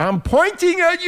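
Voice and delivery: high-pitched, exaggerated retort; squawked comeback to an accusation; mock bickering. Real, not acted. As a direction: fire it back way up high. Mock bickering at co-host pointing.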